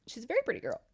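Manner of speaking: high, softened mock choked-up voice; imitating someone holding back tears; a little choked up. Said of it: "a little choked up".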